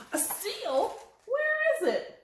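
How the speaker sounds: high squealing where is it; high delighted question; excited surprise. Acted. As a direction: gasp and look around eagerly. Excited to spot a seal.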